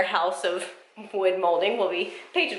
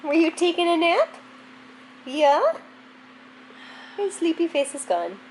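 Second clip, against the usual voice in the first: high, sing-song pet voice; 9 semitones higher than usual. Real, not acted. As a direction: coo at the dog. Talking to the dog.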